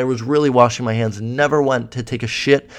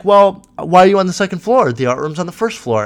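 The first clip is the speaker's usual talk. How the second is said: cocky mock vice president, pitched up at first; 6 semitones higher, 5 dB louder than usual. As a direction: imitate her cocky challenge, opening high and loud. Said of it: "she said it very cocky".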